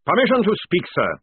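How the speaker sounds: loud, high parade-ground bark; sergeant's shouted formal request. A narrator's reading. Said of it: "barked Jackram".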